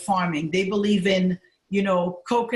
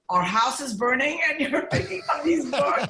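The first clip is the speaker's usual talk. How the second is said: higher, mock-incredulous husband; imitating incredulous husband; 6 semitones higher than usual. Husband questioning wife saving books during fire, mock disbelief. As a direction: retell his disbelief with pitch raised. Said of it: "My husband thought I was crazy".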